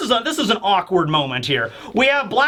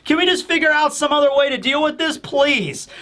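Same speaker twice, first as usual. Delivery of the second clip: higher, begging plea; pleading Kobe; 6 semitones higher than usual. Retold or imitated. Kobe pleading with Blackbeard to spare Boa, high and begging. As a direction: plead with him not to kill her. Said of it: "Kobe is pleading with them".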